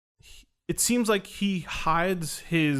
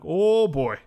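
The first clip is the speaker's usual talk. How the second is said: put-on loud, drawn-out "oh boy"; big wary exclamation; apprehensive oh boy; 6 dB louder than usual. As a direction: brace yourself as trouble approaches. Teller reacts to shaking stranger approaching.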